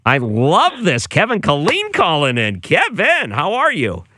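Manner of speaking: high, drawn-out, delighted greeting; thrilled, slow and high-pitched welcome; excited welcome. Real, not acted. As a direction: sing out the caller's name with big excitement. Host welcomes a special guest caller.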